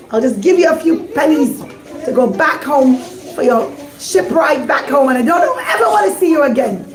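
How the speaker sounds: a furious ruler dismissing a fool; angry king. Acted. King scolds the rabbi for losing the diamond, angry. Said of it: "the king gets very angry".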